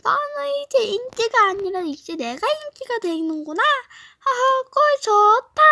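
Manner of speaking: 높고 크게 비웃는 여학생; 인기를 빼앗은 듯 깔보며 놀리기; mocking girl classmate. 여자애 친구 역할 조롱. (키 작아진 친구를 놀리는 높은 목소리)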